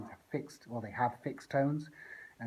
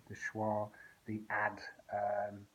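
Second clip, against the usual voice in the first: ordinary talk, nothing marked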